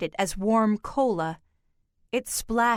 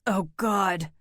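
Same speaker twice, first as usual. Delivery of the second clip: moaning; 2 semitones lower than usual. A narrator's reading. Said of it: "he moaned".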